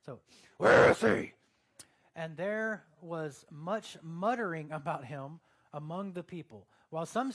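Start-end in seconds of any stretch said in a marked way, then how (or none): 0.6-1.3 very loud, forceful vigilante growl; Batman. Acted. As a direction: roar it like a masked crimefighter. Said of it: "I read it in the Batman voice".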